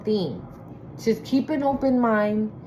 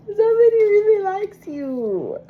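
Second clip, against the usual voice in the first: more emotional; 10 semitones higher, 4 dB louder than usual. Real, not acted. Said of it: "I feel so emotional".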